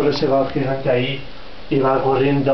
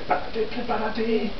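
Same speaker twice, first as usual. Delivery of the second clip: hushed warning; 9 semitones higher, 6 dB quieter than usual. Acted. Crowd whispers warning.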